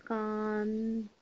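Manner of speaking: drawn-out dictation syllable; slow classroom dictation; slow spelling. Shown as a demonstration. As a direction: stretch it out for writing. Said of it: "बिंदी लगाएंगे".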